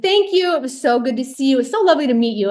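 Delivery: bright polite goodbye starting high, easing down; overly gracious thanks on the way out; gushing farewell, imitated. Retold gushing goodbye as leaving.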